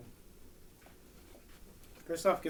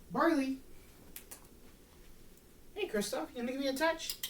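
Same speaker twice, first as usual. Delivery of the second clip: much higher, eager encouraging cues to the dog; energetic high call; 10 semitones higher than usual. Real, not acted. Trainer keeping the sluggish dog's energy up, high and eager. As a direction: pump up the energy so he stays keen. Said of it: "keep the energy going".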